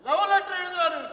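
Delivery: usual speaking voice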